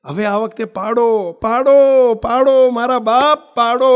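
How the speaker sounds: rising to a loud plea, begging; loud pleading. Real, not acted. Preacher begging the listeners to say yes, loud plea. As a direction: beg them loudly, building up. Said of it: "આ બે હાથ જોડીને કહું છું".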